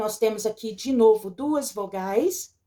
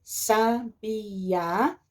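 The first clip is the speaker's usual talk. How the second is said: SABIÁ drawn out as sa, bi, á; syllable by syllable; 3 dB quieter than usual. Shown as a demonstration. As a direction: slowly split "sabiá" into three syllables. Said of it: "Sabiá é separado".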